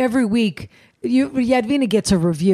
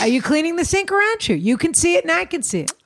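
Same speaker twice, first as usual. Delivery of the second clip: stern scolding; 6 semitones higher than usual. Retold or imitated. Scolding quoted employee.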